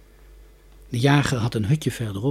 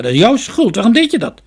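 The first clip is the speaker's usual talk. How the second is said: loud, raised scolding; indignant telling-off; angry blaming; 9 semitones higher, 9 dB louder than usual. A narrator's reading. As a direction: accuse him with pitch and volume up. Hunter blames the boy.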